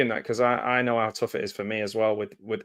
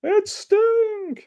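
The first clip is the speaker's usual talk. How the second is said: squealed. Acted. A fan spotting [name] on screen, squealing it in falsetto.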